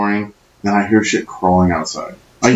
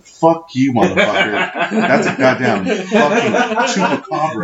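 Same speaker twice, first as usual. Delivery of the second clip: laughing protest; 8 semitones higher than usual. Retold or imitated. Insisting it is a chupacabra.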